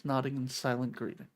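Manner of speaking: ordinary talk, nothing marked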